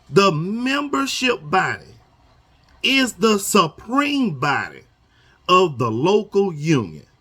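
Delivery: slow, word by word, like reading a rule aloud; slow deliberate reading. Shown as a demonstration. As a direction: read it slowly so every word lands. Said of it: "I'ma read this slow".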